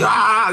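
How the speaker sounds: a quick imitated goblin shriek; goblin scream. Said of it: "gritaba el duende". (grito imitado de duende)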